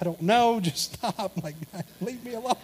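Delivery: high, pleading whine; whiny plea. Retold or imitated. Husband nagged by his wife about the trash, high whine. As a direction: beg to be left alone, voice cracking up. Dad cornered by wife's nagging.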